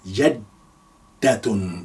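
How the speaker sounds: "Jaddatun" drawn out very slowly, doubled d; slow careful "Jaddatun"; slow demo. Shown as a demonstration. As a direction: spell out "Jaddatun" slowly for learners. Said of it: "you say jaddatun".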